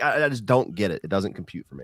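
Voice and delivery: ordinary talk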